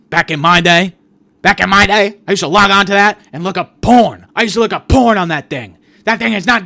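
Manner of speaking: loud, high indignant tirade; ranting yell. Acted. Dad argues with his kid about AI feelings, loud angry rant. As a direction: yell like an outraged old-timer, climbing in pitch.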